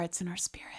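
mock solemn whisper. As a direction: breathe it like a secret. Mock solemn about hometown tradition.

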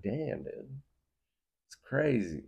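soft, high, amazed aside; hushed awe. Acted. Listener reacts to trailer guy, in hushed awe. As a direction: murmur it high and impressed.

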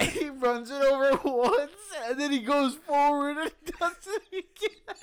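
high, loud laughing through words; can't stop laughing while telling it. Real, not acted.

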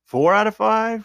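high, drawn-out incredulous question; can't believe anyone would complain; shocked disbelief. Real, not acted. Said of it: "It is pretty high".

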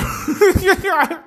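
high, loud cackle of laughter; bursting laugh. Real, not acted. Reading a college meme aloud, high laughing outburst. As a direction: crack up mid-read.

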